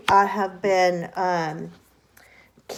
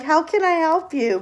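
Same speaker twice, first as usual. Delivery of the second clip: higher polite phone greeting; prim work-phone voice, pitched up; 8 semitones higher than usual. Retold or imitated. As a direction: answer sweetly like a receptionist.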